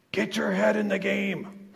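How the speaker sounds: high, slow coach's yell; barking gym coach. Retold or imitated. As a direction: bark it like a coach from the sideline. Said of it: "coach yelling his classic rebuke".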